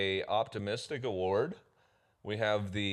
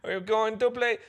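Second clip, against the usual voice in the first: high-pitched put-on echo; playful high mimicry; mock echo; 11 semitones higher than usual. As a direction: parrot it back high and silly. Echoing partner's put-on voice.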